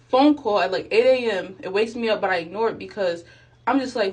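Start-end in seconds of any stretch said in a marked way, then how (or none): none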